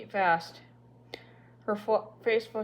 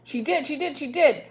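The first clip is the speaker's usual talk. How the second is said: higher, louder insistent repetition; ranting; 7 semitones higher, 5 dB louder than usual. A narrator's reading. Annabel ranting to back up her sister, loud and insistent. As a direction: stamp it out like an indignant child. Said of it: "ranted Annabel".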